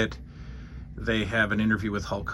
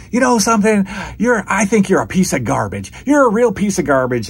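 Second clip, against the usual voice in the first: loud, much higher mock rant; Piper losing it; 9 semitones higher, 7 dB louder than usual. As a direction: snap at him like a hot-headed wrestler. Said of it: "Piper just loses it and says".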